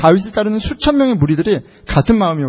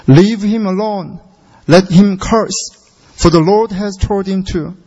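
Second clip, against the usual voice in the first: each word drawn out very slowly; slow English reading; much slower than usual. Shown as a demonstration.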